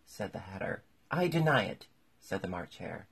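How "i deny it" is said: loud, high protest; squabbling, indignant outburst; emphatic denial. A narrator's reading. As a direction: deny it hotly, high and loud. March Hare protests in quarrel.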